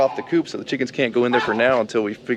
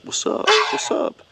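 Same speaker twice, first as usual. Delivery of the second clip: gangster voice. Retold or imitated. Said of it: "like he's a gangster coming up to you like".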